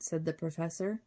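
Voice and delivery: ordinary delivery, nothing marked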